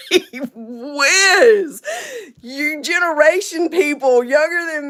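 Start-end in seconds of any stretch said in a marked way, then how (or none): 0.1-1.8 high, loud exclamation with a laugh; amused astonishment. Real, not acted. Her astonished reaction.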